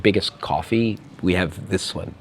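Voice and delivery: quietly baffled by the request; puzzled barista. Retold or imitated. Serbian barista to American customer, low soft puzzled reply. Barista answers coffee order.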